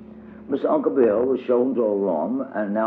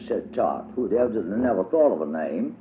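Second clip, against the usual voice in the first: plain reading voice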